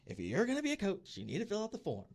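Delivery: mock exasperated official insisting; heated woman. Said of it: "now this woman was heated".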